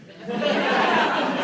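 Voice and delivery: loud high laugh bursting out; burst of laughter. Real, not acted. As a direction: laugh out loud at your own joke. Laughing at own joke.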